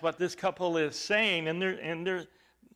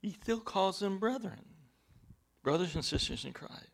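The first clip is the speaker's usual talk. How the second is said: ordinary talk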